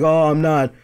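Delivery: stammered denial in a put-on Indian accent. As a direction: mimic a defensive phone agent with an Indian accent. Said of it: "That's just how he sounded".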